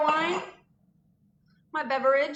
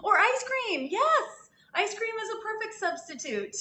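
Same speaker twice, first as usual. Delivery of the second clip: excited; 10 semitones higher than usual. Real, not acted. Excited suggestion.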